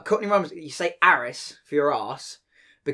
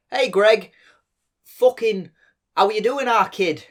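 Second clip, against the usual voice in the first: loud, higher Manchester greeting; Manchester accent; 6 semitones higher, 5 dB louder, slower than usual. Acted. Comic guessing game for his friends, Manchester accent.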